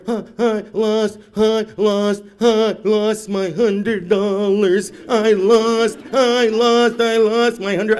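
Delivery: put-on high, loud, slow crying child voice; hammed-up child sobbing; wailing kid. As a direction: wail it like a kid in a tantrum. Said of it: "kicking and screaming on the floor".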